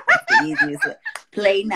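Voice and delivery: high laughing punchline. Real, not acted. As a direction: squeal it through laughter.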